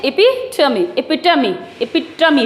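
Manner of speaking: एपिटमी said as "e-PI-tuh-mee"; teacher repeats एपिटमी in four syllables; word demo. Shown as a demonstration. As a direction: finish एपिटमी on a clear mee. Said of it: "क्या बोलते हैं".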